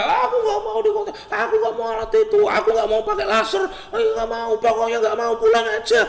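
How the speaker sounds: high-pitched hysterical kid impression; squealing, hammed-up refusal; mock child's screaming. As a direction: act out a child's shrieking tantrum. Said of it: "kenapa kok dia teriak-teriak".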